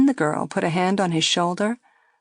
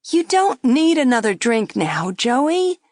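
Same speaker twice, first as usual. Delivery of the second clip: higher, louder coaxing plea; girl coaxing; 6 semitones higher, 4 dB louder than usual. A narrator's reading. Girl coaxing Joey to skip another drink. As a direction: gently press him to stop. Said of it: "the girl said".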